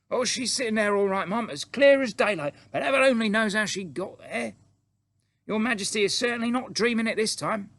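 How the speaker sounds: high, loud, indignant servant; agitated maid. Acted. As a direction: protest to your mistress in a high, loud voice. Voices the maid of the story.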